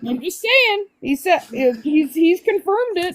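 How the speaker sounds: high upbeat friend voice; imitating Blue. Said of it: "He's like".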